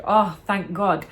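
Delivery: ordinary talk, nothing marked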